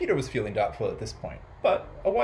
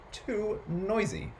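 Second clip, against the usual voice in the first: high, drawn-out grumble; exasperated complaint; 8 semitones higher, 3 dB quieter, much slower than usual. A narrator's reading. Old Peter complaining about his house, exasperated. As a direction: stretch both words out in weary protest. Old man fed up with noise.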